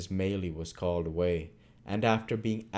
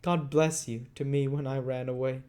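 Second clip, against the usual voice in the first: a hint of a boy's burst of affection, higher; affectionate Oliver; 6 semitones higher than usual. Oliver remembering Dick's blessing, lightly higher and fond. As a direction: gush warmly as the boy. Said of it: "with a burst of affectionate emotion".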